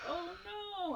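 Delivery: high, hushed little "oh no"; soft, high-pitched mock alarm; mock dread. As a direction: squeak it quietly, pretending fear. Group finds raptors surrounding them.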